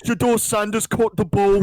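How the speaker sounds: loud high British commentator voice. Retold or imitated. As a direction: hype it like a British announcer. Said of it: "a British guy being like".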